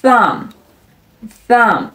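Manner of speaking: "thumb" said as "thum"; silent final B. Shown as a demonstration. Teacher showing the silent letter, "thumb" without the B. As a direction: close "thumb" on the M, B left silent. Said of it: "注意末尾的B是不发音的".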